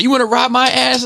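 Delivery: high, worked-up mock yell; re-enacted road-rage challenge; imitated angry driver. Imitates the furious other driver.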